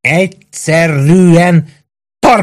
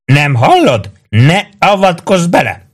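high, insistent acted shout; shouted warning; 8 semitones higher, slower than usual. A narrator's reading. Maggio warns Prue off, high insistent shout. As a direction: insist in a raised pitch. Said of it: "ordított rá Prúra".

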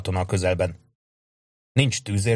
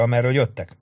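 plain reading voice

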